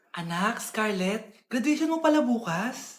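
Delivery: slow, soft, low hesitant ask; timid mother. Acted. Mother gently asking her daughter about graduation, soft and slow. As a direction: ask gently, afraid of being snapped at.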